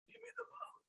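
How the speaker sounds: breathless, toneless rasping whisper; voicing his dying father, almost no breath; dying father's faint voice. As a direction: barely get the words out, as if with no air. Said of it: "my dad sounded more like this".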